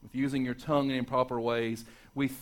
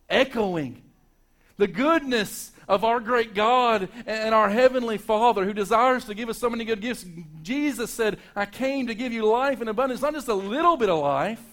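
high, loud, soaring preacher's climax; preaching at full force; 9 semitones higher, 7 dB louder than usual. Acted. Preacher to his congregation, building to a loud, high climax. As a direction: lift the congregation, ringing out over them.

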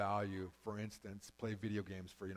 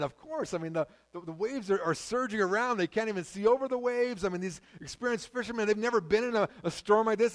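usual speaking voice

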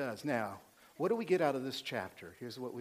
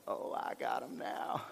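whispered gloat, very soft; gloating schemer; 9 dB quieter than usual. Acted. Preacher voicing Joseph secretly plotting against his brothers, whispered gloating. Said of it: "What I read was Joseph's going".

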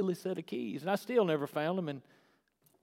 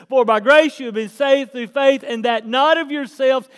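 preached loud; 6 semitones higher, 13 dB louder than usual. Shown as a demonstration. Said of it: "re-read louder".

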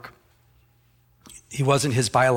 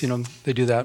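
usual speaking voice

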